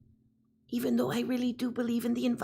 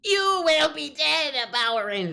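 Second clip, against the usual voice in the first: villain voice pushed higher and loud, alien timbre; Rita Repulsa; 3 semitones higher, 5 dB louder than usual. Acted. Asked by chat, playing the Power Rangers villain, loud villain snarl. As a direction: snarl it like a cartoon space witch. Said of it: "do a Rita Repulsa".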